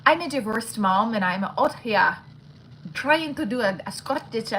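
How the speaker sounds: hammed-up, badly faked Scottish accent. Shown as a demonstration. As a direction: have a go at sounding Scottish. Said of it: "Scottish accent".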